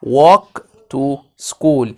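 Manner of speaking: Walk to school stretched very slow, WALK stressed; slow drilled model. Shown as a demonstration. Teacher modelling a sentence for kids, very slow and clear. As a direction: spell it out slowly for young learners. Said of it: "يعني امشي إلى المدرسة".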